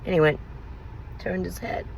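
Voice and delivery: almost crying, soft and breaking; tearful. Real, not acted. Telling viewers about his guilty face, near tears. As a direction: voice fading to a tearful hush. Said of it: "that's incredibly sad".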